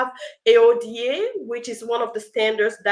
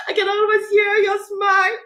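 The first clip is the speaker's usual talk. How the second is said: laughing; 8 semitones higher, 5 dB louder than usual. Real, not acted. Rereads comment, laughing with delight.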